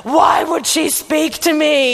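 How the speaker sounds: sulky and offended at being spoken to; grumpy indignation. Retold or imitated. Roused too early by a roommate, mock indignant whine. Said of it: "unreasonably angry".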